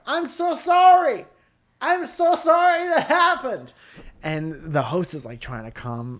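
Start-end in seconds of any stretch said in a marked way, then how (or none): 0.1-3.7 very high, loud, drawn-out apology; stranger wailing apologies. Retold or imitated. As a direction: mimic a frantic woman shouting over the show. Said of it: "And in the background".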